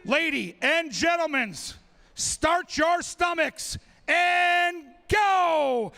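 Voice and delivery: very slow, booming start call; race start call. Acted. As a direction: drag out the starting command.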